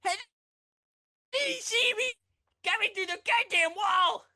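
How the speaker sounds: slow, howling cursing, very high and loud; raging gamer. Retold or imitated. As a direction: wail at the screen in fury. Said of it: "She, she's like".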